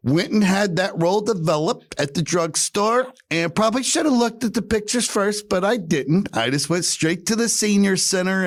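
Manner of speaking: high, lightly voiced grandma; chirpy high old lady telling a story; sweet old lady. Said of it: "And she goes".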